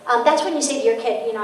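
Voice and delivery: normal talking voice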